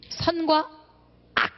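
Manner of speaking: ordinary delivery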